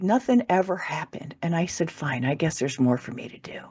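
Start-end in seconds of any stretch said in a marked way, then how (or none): 1.9-3.6 resigned whisper. Retold or imitated. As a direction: give in with a sigh. Resigned inner reply.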